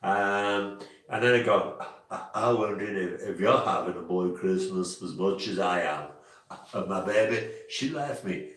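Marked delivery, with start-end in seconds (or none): 2.1-8.5 low mock [name] speaking voice. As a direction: lower spoken [name]-style patter. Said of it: "I had to get into my [name]".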